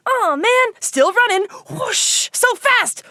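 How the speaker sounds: high, hammed-up excitement; mock breathless run. Host acting out running away, hammed-up excitement. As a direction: sell the frantic chase.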